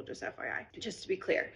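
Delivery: whispered, under the breath; whispered aside. Real, not acted. Vlogger adds a hushed aside to her followers, whispering. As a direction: drop to a whisper for the disclaimer.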